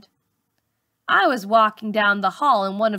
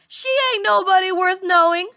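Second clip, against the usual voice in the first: put-on boy butting in; 8 semitones higher, 3 dB louder, faster than usual. A narrator's reading. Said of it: "John John butts in".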